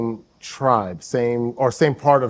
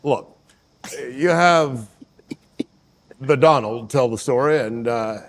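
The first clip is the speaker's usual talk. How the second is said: halting presidential impersonation; Barack Obama. Acted. Complaining about his rival's storytelling, Obama impression. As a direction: weigh each phrase, with long pauses. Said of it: "But then you won't do your Barack".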